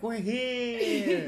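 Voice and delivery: loud, high and laughing; shouting out the window. Retold or imitated. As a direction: call out from the window. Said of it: "Abby literally stuck her head out".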